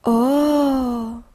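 long drawn-out wondering oh; amazed. A narrator's reading. Pieps seeing the shining colours, amazed. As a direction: marvel with a held, gliding sound. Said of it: "Pieps staunte".

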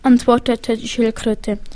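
ordinary delivery

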